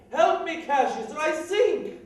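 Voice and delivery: high, loud mock cry for help; Caesar's desperate cry. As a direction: cry out like a drowning man, mimicking him. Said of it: "Caesar cried".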